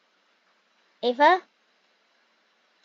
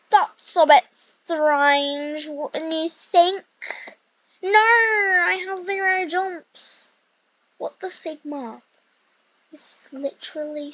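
slow, drawn-out mock British accent; playful British or maybe Australian accent; put-on British accent; 4 semitones higher, slower than usual. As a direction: slip into a wandering British-Scottish accent. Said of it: "a British accent or, like, a Scottish accent".

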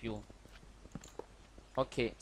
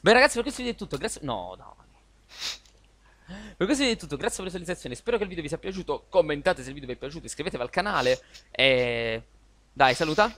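youtuber outro; 3 dB louder, faster than usual. Acted. Video outro sign-off.

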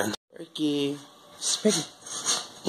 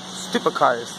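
ordinary talk, nothing marked

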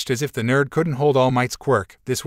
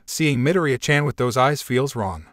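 ordinary delivery, nothing marked

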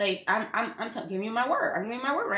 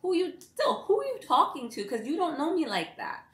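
higher, indignant "who are you talking to"; sassy inner retort; 6 semitones higher than usual. Retold or imitated. To a yelling roommate, higher indignant sass. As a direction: snap back with attitude, pitched up. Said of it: "I'm just sitting here like".

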